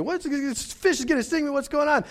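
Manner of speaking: put-on a kid panicking about the catfish's sting; startled kid.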